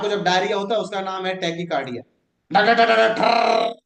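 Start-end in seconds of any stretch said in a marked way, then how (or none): 2.5-3.8 loud thumping dhak dhak; loud heartbeat imitation; heartbeat sound. Shown as a demonstration. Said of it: "ऐसे धड़कता है दिल".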